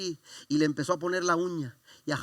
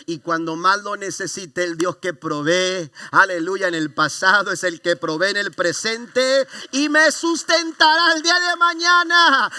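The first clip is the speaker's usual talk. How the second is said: much higher and louder, the pastor lifts to a shouted climax; preaching at full force; 3 semitones higher, 8 dB louder, slower than usual. Acted. Pastor crying out to the congregation at the climax of his testimony, at full force. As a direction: pour it out like a revival preacher.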